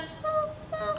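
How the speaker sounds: very high mock puppy whimper; imitated puppy whimper. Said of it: "he started crying".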